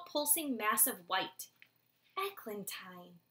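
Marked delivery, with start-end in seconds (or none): 2.2-3.2 much softer, slow, hushed; soft whisper. Acted. Soren leaning over his baby sister, soft whisper. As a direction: breathe the name tenderly to the chick. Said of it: "he whispered softly".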